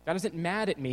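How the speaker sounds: ordinary delivery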